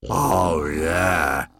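gruff, sarcastic agreement; heavily sarcastic creature; open sarcasm. A narrator's reading. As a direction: drawl it with undisguised sarcasm. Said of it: "with no attempt to disguise his sarcasm".

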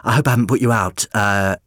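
fast, nervous babble; fast flustered. Acted. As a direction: rush it nervously.

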